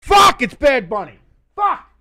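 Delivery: loud high furious curse; angry outburst. Real, not acted. Streamer hearing the halftime act, furious shout. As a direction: blurt out the rage at the news.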